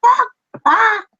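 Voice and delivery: high, loud squawking bird call; shrill gull squawk; seagull cry. Acted. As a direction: screech it like a seagull. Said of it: "it cries out at you, going".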